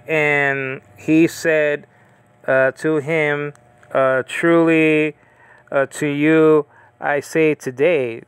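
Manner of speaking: very slow, word by word, every phrase set apart; slow deliberate scripture reading; slow careful reading. Shown as a demonstration. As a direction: read it out slowly like a verse being checked. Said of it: "It doesn't say".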